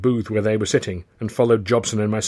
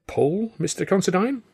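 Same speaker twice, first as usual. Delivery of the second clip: nervous squeak, much higher; gulping; 10 semitones higher than usual. Acted. Alf caught out by the boss, a high nervous gulp. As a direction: play dumb, voice jumping up in fright. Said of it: "Alf gulped".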